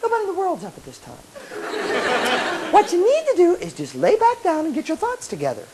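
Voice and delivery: soft, high tempting little voice; coaxing inner voice. Acted. Little voice luring a student back to bed, coaxing. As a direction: coax high and hushed. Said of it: "little voice says".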